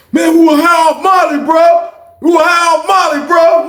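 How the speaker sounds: high, loud desperate excuse; pleading shout. Retold or imitated. Guy pleading with Chris that they were high, pleading shout. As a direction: beg him to understand.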